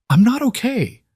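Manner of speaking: high, loud burst of despair; outburst. Acted. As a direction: blurt it out, cracking. Lena bursting out.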